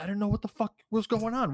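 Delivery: high, quick mock bewilderment; imitated baffled protest. Confused boyfriend answering his angry girlfriend, high and hurried protest. Accused boyfriend voiced, pleading ignorance.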